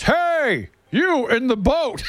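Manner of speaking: high, drawn-out holler, no real volume; imitating an irate man screaming from shore; mock scream. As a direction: holler as if across water, held back in volume. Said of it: "he starts screaming at us".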